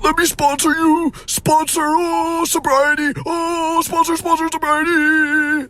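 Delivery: very high falsetto mockery; mock eager clamouring. Mocking people huddled round offering sponsorship, high squealing voice. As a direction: squeal it like an overeager swarm.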